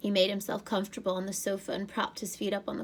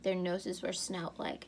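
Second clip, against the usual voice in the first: usual speaking voice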